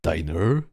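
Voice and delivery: "Diner" with a hard American r at the end; testing American diner. Shown as a demonstration. Streamer asking chat if "Diner" sounds right. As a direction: say "Diner" ending on a strong er sound. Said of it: "did I make it correctly?".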